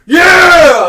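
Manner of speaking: high, drawn-out mock scream; full-volume impression of a victory yell; imitated wrestler's yell. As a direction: holler it like a hyped-up wrestler. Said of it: "and he's like".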